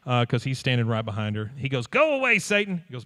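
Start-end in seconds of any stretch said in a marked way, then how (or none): 1.9-2.8 put-on high, louder child's cry; kid shooing Satan. Son rebuking his costumed father, high childish cry. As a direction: push the devil away like a scared boy.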